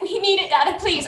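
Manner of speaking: put-on high, small child voice, upset; acted tearful pleading. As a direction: plead like an upset kid to a parent. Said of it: "he's sobbing".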